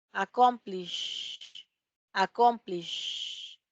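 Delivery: accomplish drawn out very slowly, holding the final "sh"; slow demonstration. Shown as a demonstration. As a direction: stretch accomplish so the class hears the final "sh". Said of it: "No hay vibración". (accomplish muy despacio, alargando la "sh" final)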